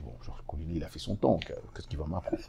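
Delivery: soft, dismissive inner mutter; muttered scoff. Retold or imitated. Journalist scoffing inwardly at the veteran teacher, quiet mutter. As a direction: mutter it to yourself, unimpressed. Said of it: "je dis ça intérieurement".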